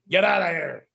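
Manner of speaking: Andre's gruff brush-off, shouted; retold angry shout. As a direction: bark it at the autograph seeker. Andre snapping at a fan.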